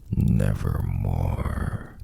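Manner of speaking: low, slow, ominous croak; raven voice. Acted. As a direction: drag it out like a dark bird. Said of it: "Then the bird said".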